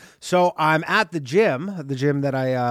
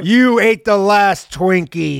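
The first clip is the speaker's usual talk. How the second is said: slow mock accusation; 6 semitones higher, 7 dB louder, much slower than usual.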